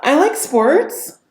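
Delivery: slow, high, loud acted fear; fearful. Shown as a demonstration. Coach showing fear on a sample line, fearful. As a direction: push the pitch way up as if scared.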